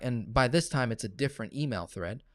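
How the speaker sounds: ordinary talk, nothing marked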